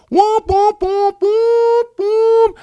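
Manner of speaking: falsetto whoop whoop whoop; way up high, whooping like a siren; giddy joyride whoops. Retold or imitated. Joyride screaming round neighborhood.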